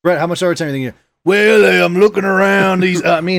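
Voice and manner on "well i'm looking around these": a touch of a cowboy drawl, louder; mock cowboy at work. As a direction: slip into a badass cowboy voice. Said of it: "using that voice".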